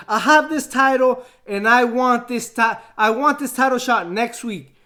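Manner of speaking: loud defiant challenge; angry demand. Retold or imitated. Champion yelling that he wants his title shot. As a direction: bark out the demand like a furious champion.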